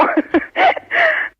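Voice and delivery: high giggling burst; real burst of laughter. Real, not acted. Woman joking with the caller about his magazine, high laughter. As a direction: break up in high-pitched laughter. Laughs after advising against subscription.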